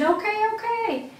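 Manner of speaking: imitating a tearful, high-pitched plea; retold crying. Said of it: "And then I started crying".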